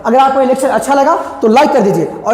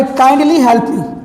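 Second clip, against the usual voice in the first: slow request; much slower than usual. Shown as a demonstration. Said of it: "या बोले".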